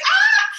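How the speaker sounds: sudden very high, loud panicked yelp; panicked shriek. Retold or imitated. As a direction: let out a flustered shriek. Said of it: "And I'm like".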